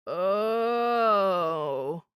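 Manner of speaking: long, drawn-out ghostly moan; sustained, woeful ghost groan. A narrator's reading. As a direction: moan out a swelling oh. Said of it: "Culpepper moaned".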